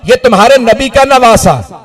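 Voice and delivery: keening preacher's lament; lamenting sermon at full force. Acted. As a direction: cry the tragedy out to the mourners.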